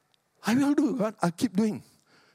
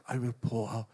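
said again much softer and lower; soft repeat; 8 semitones lower, 7 dB quieter than usual. Shown as a demonstration. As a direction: drop it to a near hush.

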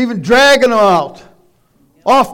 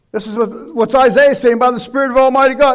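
normal talking voice

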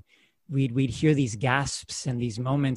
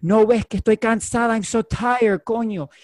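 mock tired mother, pitched up, Spanish-English; higher voice of a worn-out mother; mother complaining; 6 semitones higher, 3 dB louder than usual. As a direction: complain like an exhausted mom. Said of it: "being like".

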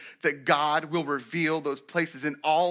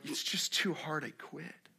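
slow, soft, trailing away; defeated giving up; 10 dB quieter, slower than usual. Acted. Believer facing conflict and quitting, soft defeated voice. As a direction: let it sink to almost nothing as you quit.

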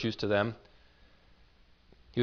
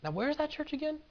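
mock puzzled question, pitched much higher and softer; imitating a friend's question; 6 dB quieter than usual. Old classmate asking the pastor where his church is, pitched high and soft. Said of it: "they asked me".

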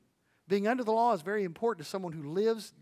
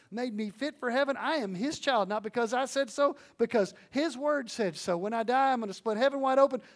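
rising, louder, higher testimony build; fervent preaching; 3 semitones higher, 3 dB louder than usual. Acted. Preacher testifying to congregation, fervent and rising. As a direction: preach it like you're proclaiming your salvation.

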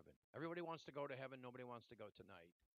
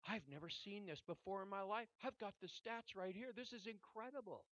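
higher, imitating an overwhelmed nurse; retold tearful amazement; 6 semitones higher, 5 dB louder than usual. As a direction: voice her tearful disbelief, pitched up. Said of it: "She's crying and she says".

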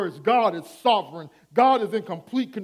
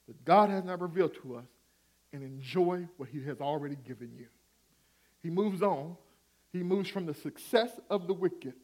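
ordinary talk, nothing marked

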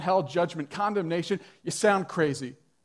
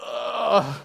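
drawn-out, higher groan of dismay; exasperated, acted-out "ugh"; 5 semitones higher, 3 dB louder than usual. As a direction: groan "ugh" as if wincing at bad theology. Retold dismayed reaction to cashier.

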